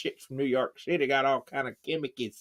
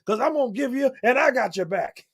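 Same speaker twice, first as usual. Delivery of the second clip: much higher pep-talk voice; Ali cheering on; 11 semitones higher than usual. Retold or imitated. Ali cheering his grandson before the fight, much higher. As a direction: cheer your grandson from heaven. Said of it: "I bet you Ali".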